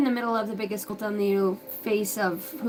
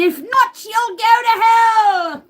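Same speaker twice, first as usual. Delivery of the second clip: slow and hushed at first, then a loud shout on the threat; mock cult preacher; 6 dB louder, slower than usual. Mocking a cult preacher to viewers, slow then shouting.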